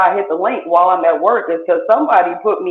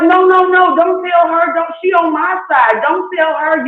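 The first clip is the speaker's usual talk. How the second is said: panicked pleading; 7 semitones higher than usual. Retold or imitated. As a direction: beg her not to tell. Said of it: "you like".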